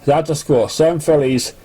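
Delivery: plain reading voice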